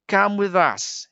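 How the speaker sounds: high, slow coaxing lure; sly fox coaxing a puppet to follow. A narrator's reading. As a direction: sweet-talk the puppet, drawn out. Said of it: "he coaxed".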